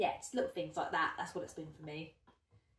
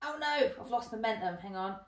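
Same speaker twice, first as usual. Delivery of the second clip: normal talking voice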